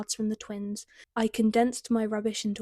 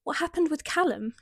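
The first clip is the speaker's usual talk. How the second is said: panicked; 6 semitones higher than usual. A narrator's reading. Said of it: "her voice laced with panic".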